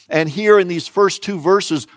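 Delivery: usual speaking voice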